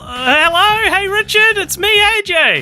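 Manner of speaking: very high squeaky cartoon voice; exaggerated cartoon intonation. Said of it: "why are you talking like that?".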